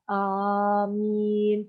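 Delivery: amin drawn right out, "aaamiiin"; long vowels. Shown as a demonstration. Lecturer contrasting Arabic word meanings, amin stretched long on both vowels. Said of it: "the length of the sound can change the meaning".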